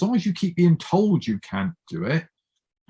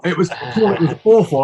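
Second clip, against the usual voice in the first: louder, rueful; loud rueful admission; 4 semitones higher, 6 dB louder than usual. Real, not acted. Admitting his old scorn to the host, rueful and loud. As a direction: own up to it loudly, half laughing.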